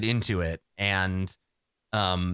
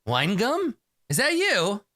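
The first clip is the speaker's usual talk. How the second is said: high, quick mock-delighted recognition; fake delighted surprise; 12 semitones higher than usual. Keeb greeting his old classmate Wine Gum, high and gushing. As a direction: gush like you just remembered him. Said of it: "he's like".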